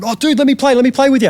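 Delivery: breaking in with an eager retold plea; eager pleading. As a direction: imitate an excited bandmate asking to join in. Retold eager plea from running drummer.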